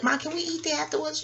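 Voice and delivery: put-on high, soft little-girl ask; childlike plea. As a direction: plead like a hopeful kid. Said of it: "And I was like".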